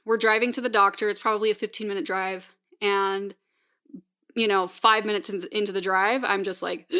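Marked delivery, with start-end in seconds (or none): none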